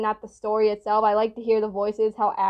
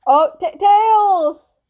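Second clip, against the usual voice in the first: alarmed cry; 7 semitones higher, 7 dB louder, much slower than usual. Real, not acted. Friends separated, calling after Tails.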